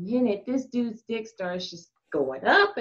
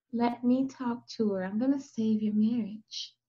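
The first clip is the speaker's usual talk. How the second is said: calm persuasion; 3 semitones lower, 9 dB quieter than usual. Retold or imitated. Calmly persuades panicking client.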